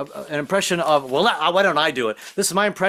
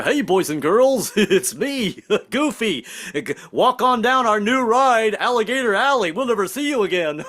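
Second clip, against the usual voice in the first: higher goofy cartoon voice with giggles; 5 semitones higher than usual. Goofy welcoming visitors at the Disney gate, silly and high. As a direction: greet the kids as a dopey cartoon dog. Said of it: "Goofy will meet you there".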